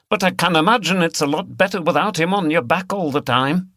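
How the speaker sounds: plain reading voice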